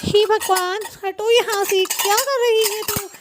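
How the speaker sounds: high, fretful order; exasperated scolding. Acted. Mother-in-law moving Kamlesh away from the dishes, exasperated.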